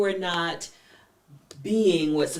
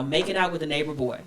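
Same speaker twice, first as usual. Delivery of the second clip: normal talking voice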